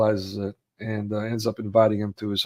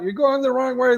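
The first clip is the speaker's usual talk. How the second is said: screaming warning; 14 semitones higher, 4 dB louder than usual. Retold or imitated. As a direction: yell it across the barrier. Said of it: "they're screaming".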